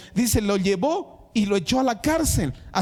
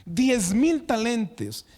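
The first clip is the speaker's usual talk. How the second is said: slow, weighty diez mil talentos; emphatic amount; 4 semitones higher, slower than usual. Shown as a demonstration. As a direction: stretch out "diez mil talentos" to stress the sum. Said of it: "Escuchen eso".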